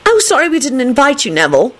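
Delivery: high, insincere apology; airy, falsely sorry; unconvincing. A narrator's reading. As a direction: apologise without meaning it. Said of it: "she added unconvincingly".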